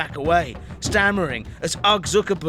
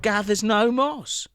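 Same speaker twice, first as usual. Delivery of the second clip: higher, slow gloating proverb; smug Zuckerberg; 5 semitones higher, slower than usual. Acted. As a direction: savour the punchline. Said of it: "Mark Zuckerberg".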